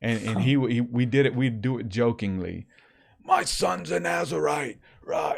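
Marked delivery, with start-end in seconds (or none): 3.2-5.3 slow, higher ranting preacher voice; preacher impersonation. Retold or imitated. Friend imitating Lou Engle on the podcast, ranting. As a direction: rant it like a fiery evangelist. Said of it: "really good impersonation of Lou Engle".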